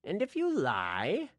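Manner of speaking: slow, taunting villain voice; menacing villain. Acted. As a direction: draw out the threat. Towin threatens the cook.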